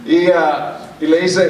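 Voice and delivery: ordinary delivery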